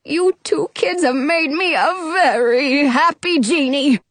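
choked-up grateful genie; tearful joy. A narrator's reading. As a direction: let happiness wobble toward tears. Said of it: "he cries".

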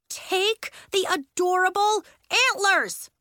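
high, loud, drawn-out order; insistent, heavily stretched command; exasperated demand. A narrator's reading. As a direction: punch each word slowly and loudly. Demand repeated, escalating at Elliot.

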